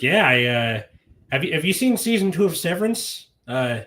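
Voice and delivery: put-on chatty small talk in a mild Midwest accent. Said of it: "Midwest accent for that".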